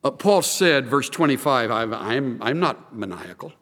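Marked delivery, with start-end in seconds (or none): 1.7-3.5 low, soft, halting reply; calm denial. Acted. Paul answering Festus's charge of madness, calm and low. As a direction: answer the accusation quietly, without heat.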